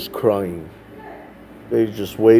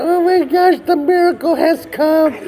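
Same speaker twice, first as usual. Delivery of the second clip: put-on excited little girl squeal; 3 dB louder than usual. A little girl spotting Mister Sonny arrive, squealing with joy. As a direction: shriek it out with joy like a small girl.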